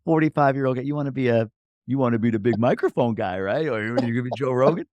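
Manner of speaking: mock condescension. Host voicing the doubters of his podcast goal, mock condescending. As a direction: scoff at the big dream, lightly.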